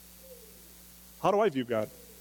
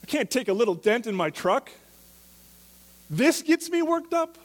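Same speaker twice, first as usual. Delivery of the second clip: mock self-reproach; 5 semitones higher than usual. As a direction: poke fun at yourself, rising to disbelief on the last line. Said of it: "And I'm like".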